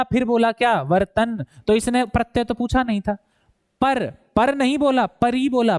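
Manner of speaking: ordinary talk, nothing marked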